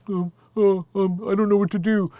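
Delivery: a hint of a flustered boyfriend; imitating timid Thomas. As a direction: mumble like the helpless babysitter. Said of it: "who's like this".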